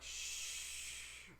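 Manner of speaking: drawn-out hushing "shhh"; long shush. Retold or imitated. Hushing a drunk friend talking about pizza, long shush.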